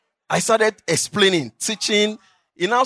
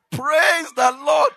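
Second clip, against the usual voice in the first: mock proclamation; 9 semitones higher, much slower than usual. Retells first bus preaching.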